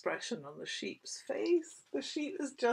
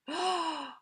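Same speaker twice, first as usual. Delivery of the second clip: sharp gasp; 10 semitones higher, 3 dB louder than usual. Acted. Lightning scare.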